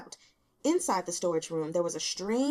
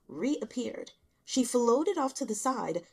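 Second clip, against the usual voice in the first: ordinary delivery, nothing marked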